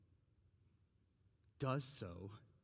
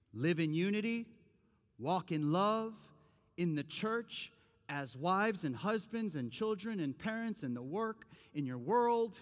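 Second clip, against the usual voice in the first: preaching push; 6 semitones higher, 5 dB louder than usual. Acted. Preaching builds.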